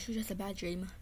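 usual speaking voice

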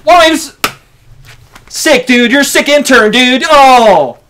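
loud high insults; angry yelling. Acted. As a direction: yell it at him. Staged quarrel, yelling.

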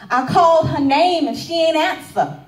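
high, loud cry of distress; acted anguish. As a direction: burst out in panic for an unanswering sister. Recalls finding her sister dead.

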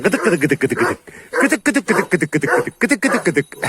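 sing-song chicken calling. Real, not acted. Calling the hens over in a sing-song chant.

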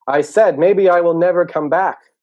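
high, slow, very loud yell. Acted. Turtle turning to the two sleepers, yelling. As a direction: yell it emphatically to wake them. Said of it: "So he turns around and yells".

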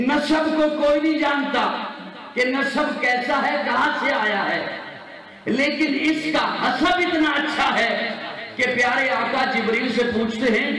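high, forceful sermon crescendo; full-force preaching. Real, not acted. Preacher building to the Prophet's question, impassioned high preaching.

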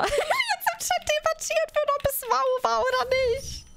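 high laughing voice. Real, not acted. Streamer laughing about chat debating the sound. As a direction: laugh the words out.

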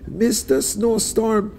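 high, slow commanding shout. A narrator's reading. Anansi shaking his fist orders the snowstorm, high and slow. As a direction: order the storm to stop.

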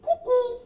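high cuckoo; mock cuckoo; broken clock cuckoo. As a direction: chirp it like the clock. Clock sound imitated.

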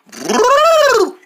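high trumpeting brrr; elephant trumpet. Acted. Quiz game, elephant trumpeting high. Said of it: "What about this one".

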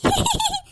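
gloating cackle. Acted. Triumphant cackle after the fake search.

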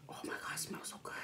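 hushed, fast whisper. Real, not acted. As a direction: breathe it out in awe. Smelling the burger.

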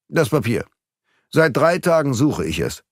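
insistent, pressing villain; firm, emphatic threat; menacing demand. A narrator's reading. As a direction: demand it with impatience. Villain demands the paper at gunpoint.

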